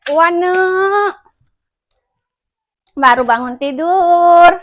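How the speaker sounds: loud, drawn-out playful call. Real, not acted. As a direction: call the little one brightly, stretching the words. Calling to the baby just awake. (memanggil bayi dengan lantang)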